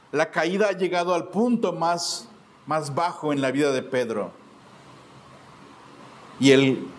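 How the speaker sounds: ordinary delivery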